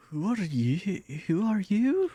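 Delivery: rising, panicky voice of a startled nobody; confused commoner Ragnar. Retold or imitated. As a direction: play a commoner baffled by strangers. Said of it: "just a normal Ragnar that he's just".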